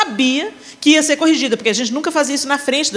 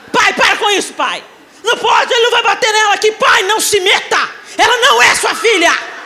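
loud, higher yelling at dad; heated shout; 8 semitones higher, 3 dB louder than usual. Retold or imitated. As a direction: shout him down in a family quarrel. Heated argument with her father.

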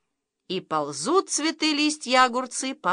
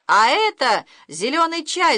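higher, louder shout; Lyosha shouting; 6 semitones higher, 5 dB louder than usual. A narrator's reading. Boy shouts to his parents that the tea cured them. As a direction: call out the discovery triumphantly. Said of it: "закричал Лёша".

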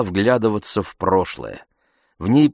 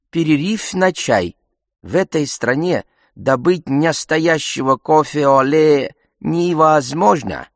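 higher, hammed-up mock French accent; bad French accent; 8 semitones higher, 4 dB louder than usual. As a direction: parody a professor with an exaggerated French accent. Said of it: "Изображая скверный французский акцент, он пародировал Бубакара".

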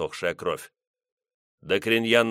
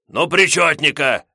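shouting; 6 semitones higher, 8 dB louder than usual. Acted. Gos shouting.

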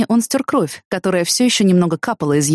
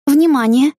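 high, raised heads-up; brief alert, pitched up; warning; 8 semitones higher, 4 dB louder, slower than usual. A narrator's reading. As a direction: call it out as a warning. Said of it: "чтобы предупредить его".

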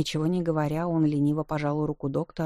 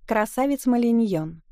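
loud announcement; 6 semitones higher, 5 dB louder than usual. Acted. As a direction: announce the guest loudly, high and proud. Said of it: "она объявила".

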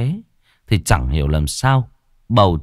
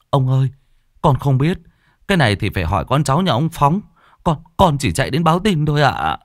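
put-on boy pleading; 6 semitones higher, faster than usual. Boy answers the old man.

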